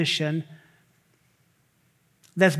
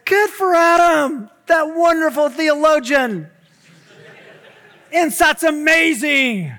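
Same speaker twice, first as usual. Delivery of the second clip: loud, high, slow sarcastic praise; hammed-up fake enthusiasm with a laugh; forced smile; 9 semitones higher, 8 dB louder, slower than usual. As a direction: smile through gritted teeth and gush. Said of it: "you have to smile and say".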